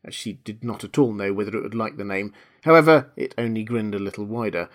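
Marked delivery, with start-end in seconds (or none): none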